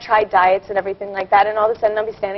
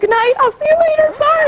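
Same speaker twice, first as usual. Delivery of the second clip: very high, squeaky mock apology; sheepish goodbye. Acting out her embarrassed exit from the event, high sheepish goodbye. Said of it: "I was like".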